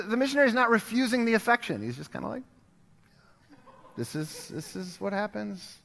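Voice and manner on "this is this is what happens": much softer, slow sheepish shrug; a hint of an awkward missionary. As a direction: mumble it like a caught-out guest.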